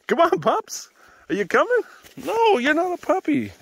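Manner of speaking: loud, very high voice for dogs; high dog-talk. Real, not acted. Owner calling pups and teasing Cooper, high and loud.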